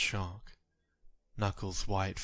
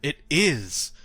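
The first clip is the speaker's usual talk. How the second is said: higher, louder protest; distraught denial; 8 semitones higher, 8 dB louder, slower than usual. Acted. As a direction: push back in disbelief against the accusation. Narrator denies accusation, distraught.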